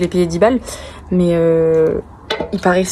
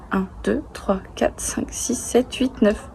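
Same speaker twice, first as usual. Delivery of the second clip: whispering; 11 dB quieter, slower than usual. Real, not acted. Counting under breath while checking.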